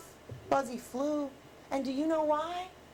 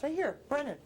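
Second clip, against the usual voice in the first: ordinary delivery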